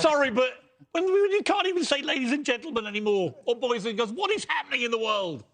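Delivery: high-pitched, exasperated British tirade; British outburst of rage. Real, not acted. British guest rants about banned greetings, outburst of rage. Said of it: "British outburst of rage".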